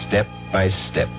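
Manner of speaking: normal talking voice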